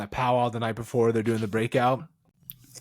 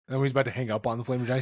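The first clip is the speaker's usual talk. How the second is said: ordinary talk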